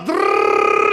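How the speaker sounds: high loud buzzing alarm; alarm clock buzz. Retold or imitated. Imitating alarm clock ring. (trillo della sveglia)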